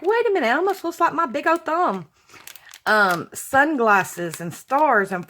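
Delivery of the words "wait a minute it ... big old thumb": mock surprise. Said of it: "I was like".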